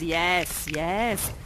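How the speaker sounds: normal talking voice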